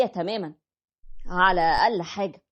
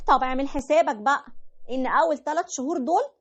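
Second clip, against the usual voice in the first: higher wife voice dictating terms; bossy wife; 5 semitones higher than usual. Retold or imitated. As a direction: play the wife setting her demands. (الزوجة بتفرض شروطها بصوت أعلى)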